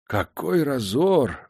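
slow aghast exclamation; shocked gasp. A narrator's reading. Manager gasping at the champagne bath, slow and aghast. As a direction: gasp at the waste. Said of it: "ахнул".

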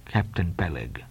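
put-on low, soft, unruffled old Quaker; composed, grave address; steady. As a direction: answer the rage calmly and deeply. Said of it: "said Bildad steadily".

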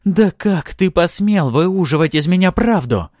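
high, loud, slow outrage; furious hissing. A narrator's reading. Succubus tricked into revealing the truth, furious. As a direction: spit it in their face, furious. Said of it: "шипела мне в лицо Артара".

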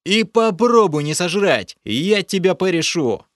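loud, high furious outburst; furious rasp. A narrator's reading. Hero rages at the creature over the dead fish, furious. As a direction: spit it at him in rage.

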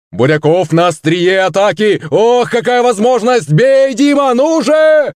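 very high, excited match commentary building up; sports announcer's shout. A narrator's reading. Little monster commentating on his own attack, frenzied announcer voice. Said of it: "голосом диктора закричал Кузьма".

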